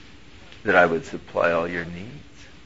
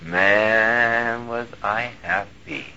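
ordinary talk, nothing marked

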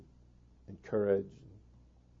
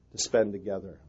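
ordinary talk

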